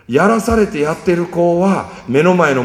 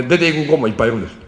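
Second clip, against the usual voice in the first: ordinary delivery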